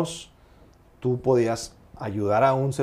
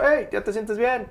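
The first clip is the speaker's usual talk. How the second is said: retold father's call; 11 semitones higher than usual. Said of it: "me gritó".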